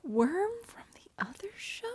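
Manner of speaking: whispering. Real, not acted. Echoing the odd message.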